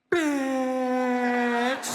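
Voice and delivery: stretched, sassy bitch with a rise; drawn-out mock insult. Shown as a demonstration. Comic demonstrates a sign, drawing out bitch. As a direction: drag it out with attitude. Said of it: "hit somebody with one of these".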